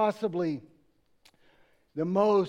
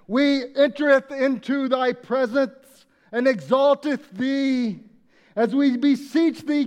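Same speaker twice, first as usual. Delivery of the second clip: much louder, slow olde English prayer; old-fashioned booming prayer; 3 semitones higher, 7 dB louder than usual. Retold or imitated. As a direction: boom it out like an old saint. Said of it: "it would go something like this".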